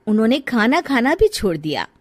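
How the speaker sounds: usual speaking voice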